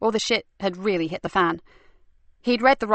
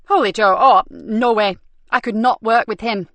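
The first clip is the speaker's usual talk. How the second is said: loud, higher protest that bursts out; flat refusal, thrown out hard; indignant outburst; 3 semitones higher, 6 dB louder than usual. Acted. Nikki to her super, refusing Holy Joe, indignant and loud.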